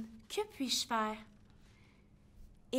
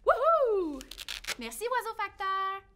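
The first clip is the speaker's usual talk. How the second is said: calling out; 7 semitones higher, 6 dB louder than usual. Real, not acted. Calling out to the postman bird.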